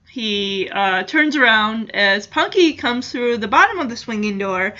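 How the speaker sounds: ordinary talk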